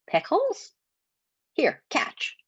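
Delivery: high, odd goblin squeak. Acted. Goblin voice in story.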